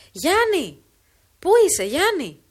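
loud, high call for the husband. Acted. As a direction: hail him from far off. Calling across the fields.

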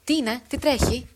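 loud call across the distance. A narrator's reading. Giannis calling loudly to the fleeing woman, loud shout. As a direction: shout to be heard from afar. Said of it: "φώναξε μεγάλη τη φωνή".

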